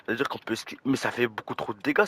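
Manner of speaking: usual speaking voice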